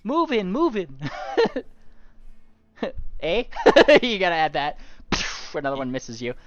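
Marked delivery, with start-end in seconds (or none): 0.0-3.4 put-on Canadian accent, higher and louder. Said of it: "Canadian accent".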